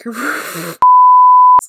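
loud, high squealing laugh; flustered laugh; embarrassed shriek-laugh. Real, not acted. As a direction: burst into a shrill giggle. Shrieking laugh at failed Korean.

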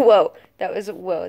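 ordinary talk, nothing marked

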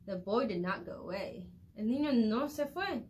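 ordinary delivery, nothing marked

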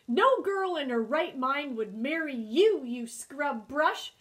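loud, scornful put-down; needling. A narrator's reading. Beaver rejects her porcupine suitor, needling him. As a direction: needle him loudly, slowing on the final insult. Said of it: "She started needling him".

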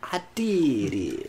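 slow, drawn-out mimicry; mocking imitation. Friend echoing the player's success, mocking drawl. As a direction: stretch it out in a put-on voice.

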